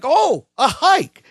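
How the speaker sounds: high, drawn-out delight; exaggerated slow, high-pitched excitement; mock enthusiasm. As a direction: stretch it out with hammed-up wonder. Voicing Bucky's reaction, acted up.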